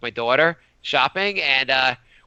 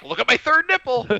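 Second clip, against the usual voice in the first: higher, lightly hammed-up call; mock announcement; 8 semitones higher than usual. The world pointing out his flaw, mockingly. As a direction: call it out as if showing everyone.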